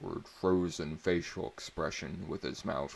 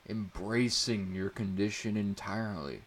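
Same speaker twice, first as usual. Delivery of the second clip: ordinary delivery, nothing marked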